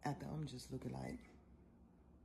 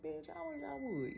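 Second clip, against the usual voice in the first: much higher, slow, taunting; inner defiance; 13 semitones higher, slower than usual. Retold or imitated. Rider thinking at the driver, high slow taunt. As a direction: dare him, high and slow. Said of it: "in my mind, I was like".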